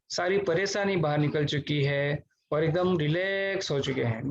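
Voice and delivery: guided meditation. Real, not acted. Teacher leading online class through deep breathing, guided meditation voice.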